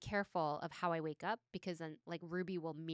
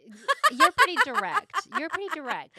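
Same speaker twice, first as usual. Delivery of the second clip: much louder, higher laughing reply; laughing loudly; 11 semitones higher, 15 dB louder than usual. Real, not acted. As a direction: burst out laughing as you say it. Friend bursts out laughing.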